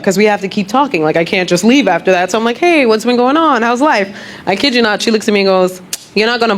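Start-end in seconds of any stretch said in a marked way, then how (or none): none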